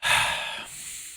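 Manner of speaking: long breathy exhale; a long, weighted sigh; heavy sigh. Real, not acted. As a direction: sigh out slowly before answering. Before answering about father's death.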